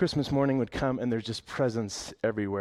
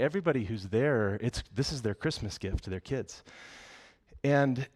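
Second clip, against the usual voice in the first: ordinary delivery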